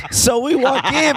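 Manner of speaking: cracking up at his own line; laughing. Real, not acted. Retelling the diner story, laughing at his own line. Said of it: "That's a really good line".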